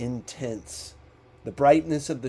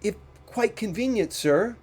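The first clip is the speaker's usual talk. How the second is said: higher, hesitant voice of a meek clerk; timid clerk; 8 semitones higher than usual. A narrator's reading. Clerk to his employer Scrooge, higher and timid. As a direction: ask it politely, hoping not to anger him. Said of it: "clerk answers Scrooge timidly".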